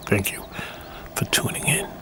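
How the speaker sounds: soft whispered sign-off; whispering. Real, not acted. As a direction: breathe it out in a whisper. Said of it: "I'm gonna be very quiet now".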